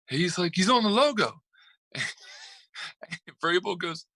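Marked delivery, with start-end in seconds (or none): 0.5-1.4 high, indignant imitated protest; mock complaint. Retelling the coach complaining about the logo, high mock indignation. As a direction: protest it with sharply raised pitch.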